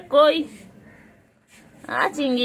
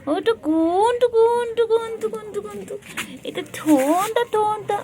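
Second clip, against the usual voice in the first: high cooing; 12 semitones higher than usual. Real, not acted. Owner cooing over her cat's little lips, high and sweet. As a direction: coo the pet name up high. Cooing pet names to cat.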